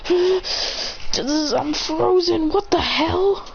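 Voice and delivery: high, stretched bewildered cry; shocked victim. Acted. Frozen opponent discovering he can't move, shocked. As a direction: disbelief at being stuck.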